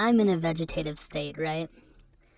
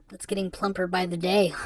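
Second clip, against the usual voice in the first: normal talking voice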